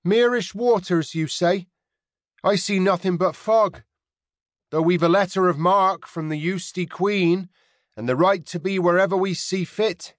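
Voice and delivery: put-on Mary hides a smile and calls out, in a rounded inland accent. A narrator's reading. Mary answers the pirate across the water, in a rounded inland accent. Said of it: "called over the water in her rounded inland accent".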